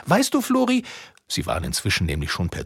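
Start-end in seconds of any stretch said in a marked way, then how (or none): 0.1-0.9 high, friendly witch voice. A narrator's reading. Witch addressing Flori at bedtime, high and friendly. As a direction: coax him sweetly, pitched high. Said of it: "hat die Hexe zum Florian gesagt".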